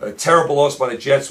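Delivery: ordinary delivery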